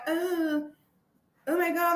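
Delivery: high, soft mock dismay; imitated helpless fretting. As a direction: mimic a useless bystander quietly panicking. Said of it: "looking around like".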